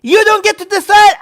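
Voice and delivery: real angry insistence; furious rebuttal. Real, not acted. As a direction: shout it as a flat refusal. Furious rebuttal in political rant.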